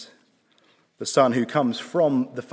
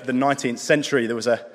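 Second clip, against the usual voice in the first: ordinary delivery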